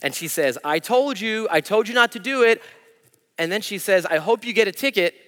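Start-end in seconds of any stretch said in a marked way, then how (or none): 0.6-2.6 higher, louder imitated scolding; retold angry wife. Husband imitates angry wife scolding him, mock shout. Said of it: "she was red hot with anger". 4.1-5.2 higher, louder imitated scolding; retold angry wife. Husband imitates angry wife scolding him, mock shout. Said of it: "red hot with anger".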